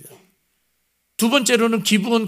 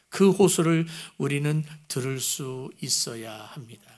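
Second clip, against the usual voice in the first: normal talking voice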